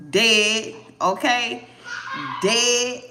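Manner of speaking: loud punchy "Dead"; emphatic loud. Real, not acted. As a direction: slam the word down. Emphatic declaration of ending.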